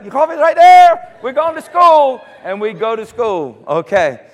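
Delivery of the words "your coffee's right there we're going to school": put-on eager child. Child's eager reply to mom.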